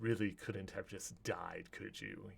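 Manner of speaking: low-key, hushed villain's menace; quiet disdain. Acted. Bertram looks down at dying Alfred, quiet disdain. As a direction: drop the voice right down, coolly.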